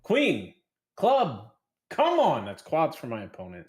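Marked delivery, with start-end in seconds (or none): 0.0-2.5 urgent high rising plea; will the card to come; excited. Real, not acted. Sweating a poker card.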